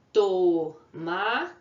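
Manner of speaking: "Tomate" split into to, ma, te with clear pauses; teacher breaking "Tomate" into syllables. Shown as a demonstration. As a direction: sound out "Tomate" slowly in three separate beats. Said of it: "na separação de sílabas".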